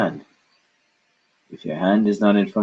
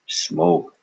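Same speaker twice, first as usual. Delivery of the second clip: normal talking voice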